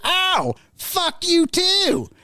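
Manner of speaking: put-on very high, drawn-out old-lady yell; high, slow mimicked retort; cussing granny. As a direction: imitate an offended granny hollering back. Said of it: "and she goes".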